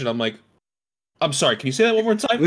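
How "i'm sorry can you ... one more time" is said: mock disbelief. Feigned disbelief in retelling.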